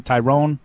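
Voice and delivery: Tyrone said with a long O; tie-RONE pronunciation. Shown as a demonstration. Host guessing at a rookie's name, Tyrone with long O. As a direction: end Tyrone like "roan". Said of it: "How do you pronounce his name".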